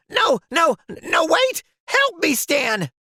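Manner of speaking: frantic call to a friend; begging Stan for help in a panic; panicked cry for help. Acted.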